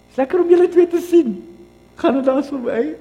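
high, bright hello to latecomers; cheery greeting. Real, not acted. Pastor greeting two latecomers, a cheerful high call. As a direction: call out a friendly welcome across the room. Greets late arrivals from pulpit.

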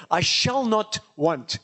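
normal talking voice

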